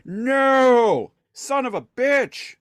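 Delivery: high, loud, drawn-out cartoon curse; mock furious roar. Megatron cursing his loss, high loud and slow. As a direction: curse the heavens slowly. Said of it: "Megatron is like".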